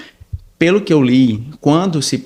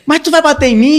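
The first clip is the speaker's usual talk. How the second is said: imitating the thief; 12 semitones higher, 4 dB louder than usual. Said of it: "Aí ele disse".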